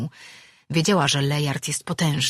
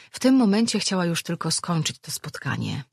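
usual speaking voice

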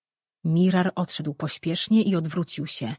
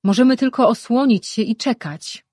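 higher and louder, carrying over the crowd; calling out; 6 semitones higher, 7 dB louder than usual. A narrator's reading. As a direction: call out to steady everyone. Rejwan calls out over crowd.

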